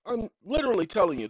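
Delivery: ordinary delivery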